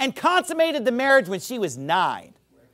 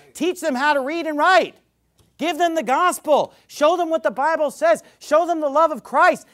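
rising preaching; 6 semitones higher, 4 dB louder than usual. Acted.

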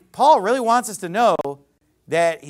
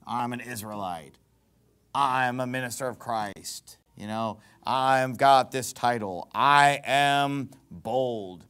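low, slow self-important boast; mock bragging; 7 semitones lower, 3 dB quieter, slower than usual. Preacher as Paul refusing to brag of titles, mock pompous. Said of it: "I'm not gonna sit here and brag".

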